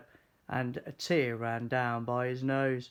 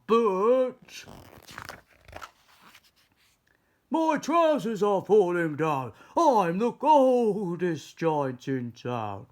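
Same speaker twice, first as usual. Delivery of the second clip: cold giant; 5 semitones higher, 6 dB louder, slower than usual. Acted. Giant ends song cold.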